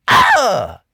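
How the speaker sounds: loud frustrated yell, drawn out; loud slow outraged cry; exasperated outburst. Retold or imitated. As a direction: vent at the hype. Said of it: "And I'm just like".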